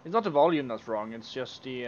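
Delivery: normal talking voice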